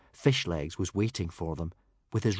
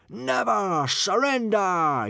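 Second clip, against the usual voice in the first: drawn-out, high, loud battle cry; happy yell; 12 semitones higher, 6 dB louder, slower than usual. A narrator's reading. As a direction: yell it like a cheering horde. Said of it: "yelled the happy hooligans".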